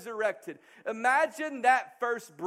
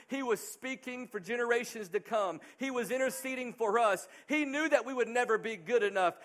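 usual speaking voice

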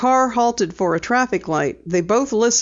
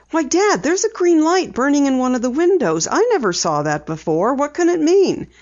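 put-on excited girl; 8 semitones higher than usual. A narrator's reading. Penny pointing out the tower light, excited. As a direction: point it out with eager wonder.

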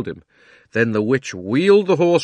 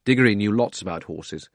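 ordinary delivery

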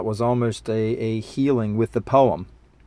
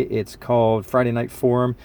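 ordinary talk

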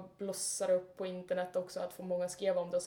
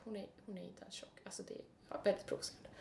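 whisper; 11 dB quieter than usual. Real, not acted. Quiet aside about [name].

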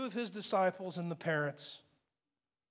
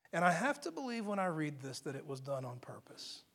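confiding whisper; 5 semitones lower, 10 dB quieter than usual. Real, not acted. Confiding aside to congregation.

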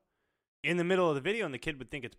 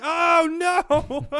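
very high, loud panicked cry; alarmed shout; 15 dB louder than usual. Retold or imitated. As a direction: yell in mock horror. Retold alarmed reaction, shouted.

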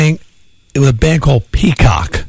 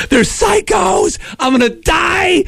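freaked-out panic; 14 semitones higher than usual. Retold or imitated. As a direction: shriek it like you're sure the guys are psychos. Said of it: "I'm freaking out in the back seat".

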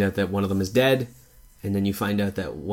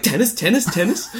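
high squeaky creature chant; chirping raptors; 11 semitones higher than usual. Retold or imitated. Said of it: "they're all like".

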